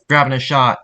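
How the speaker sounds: projected in-game callout; voice line recording. Shown as a demonstration. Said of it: "re-record a few times".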